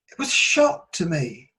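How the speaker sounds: normal talking voice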